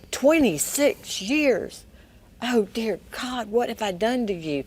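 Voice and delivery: slow, high, broken remorse; remorseful. Retold or imitated. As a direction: stretch it out as if the guilt just landed. Rick overwhelmed with remorse.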